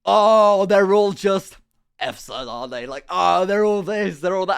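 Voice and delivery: put-on loud, high drunken Irish rant; drunk Irish guy. Drunk Irish guy ranting about the English, loud Irish accent. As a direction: bellow it like a drunk Irishman in a pub. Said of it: "And he's like".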